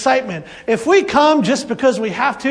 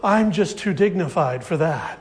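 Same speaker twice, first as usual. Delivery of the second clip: dignified attitude; 6 semitones lower, 4 dB quieter than usual. Retold or imitated. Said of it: "come with this".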